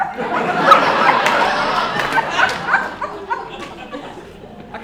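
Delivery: big real burst of laughter; high unvoiced cackle; loud laugh. Real, not acted. Laughs at absurd remark.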